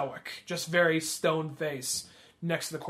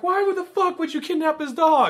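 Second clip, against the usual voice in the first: incredulous outburst; 11 semitones higher, 6 dB louder than usual. Retold or imitated. Retelling an incredulous movie line.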